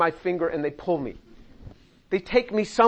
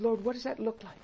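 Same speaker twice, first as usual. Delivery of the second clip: quiet musing prayer; 2 semitones higher, 9 dB quieter, faster than usual. Shown as a demonstration. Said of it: "called meditation on the scriptures".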